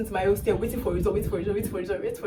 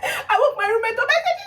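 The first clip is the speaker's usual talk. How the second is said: very high, teary, laughing; laugh-crying; 13 semitones higher, 4 dB louder than usual. Real, not acted. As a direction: tell it through happy tears. Overjoyed retelling, laugh-crying.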